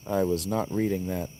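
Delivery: ordinary talk, nothing marked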